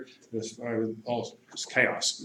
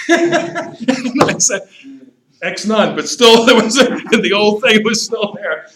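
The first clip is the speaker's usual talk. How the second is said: high, loud laughing speech; can hardly talk for laughing; loud laughter breaking through words; 10 semitones higher, 12 dB louder than usual. Real, not acted. Laughing at ex-nun's threat.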